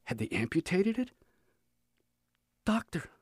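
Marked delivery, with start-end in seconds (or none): 2.7-3.1 a faltering, higher, softer call; hesitant and afraid, barely getting it out; stammered. A narrator's reading. Boy in hospital bed calls to the doctor, faltering and afraid. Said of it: "I stammered".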